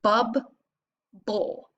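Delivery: Bub and bowl as separate beats; syllables apart. Shown as a demonstration. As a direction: say each syllable on its own. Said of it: "Put it together".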